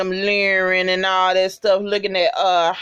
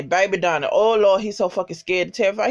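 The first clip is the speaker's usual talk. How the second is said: ordinary delivery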